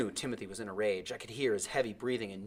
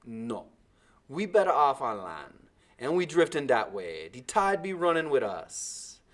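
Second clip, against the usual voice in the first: positive; 4 semitones higher, 6 dB louder than usual. A narrator's reading. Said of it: "Timothy said positively".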